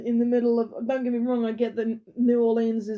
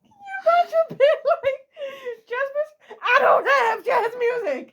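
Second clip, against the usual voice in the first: high, slow laughing shout of disbelief; laughing mock panic; 5 dB louder, slower than usual. Co-host imagining a citizen with no jazz, laughing shout. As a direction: squeal it out high through laughter.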